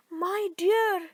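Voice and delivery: put-on high exclamation from the old woman; dismayed cry. A narrator's reading. Old woman seeing her husband come home, dismayed cry. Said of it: "she cried".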